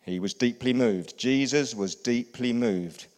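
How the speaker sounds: slow, emphatic call to repeat; he was deeply moved said with weight; congregation repeat, slow and loud. Shown as a demonstration. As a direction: have them say it back, slow and strong. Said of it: "Say with me".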